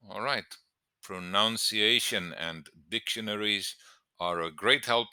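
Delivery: ordinary talk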